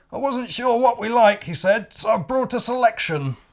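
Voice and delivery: eager high crocodile voice; crocodile character voice. Acted. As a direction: proudly offer the food, high. Crocodile returns with food.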